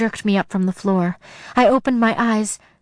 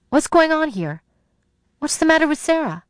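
worried and much higher, fast; alarmed mom; 8 semitones higher, faster than usual. A narrator's reading. Mom asking about Sarah, alarmed. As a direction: rush in alarmed.

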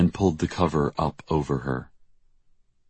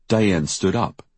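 ordinary delivery

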